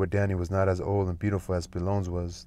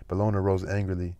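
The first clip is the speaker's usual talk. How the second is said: ordinary delivery, nothing marked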